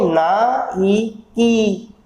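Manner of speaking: "नाइकी" very slowly as three parts, na-i-kee; broken into sounds. Shown as a demonstration. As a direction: separate and stretch each vowel in "नाइकी". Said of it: "कितने sound आ रही हैं?". ("नाइकी" तीन हिस्सों में, बहुत धीरे)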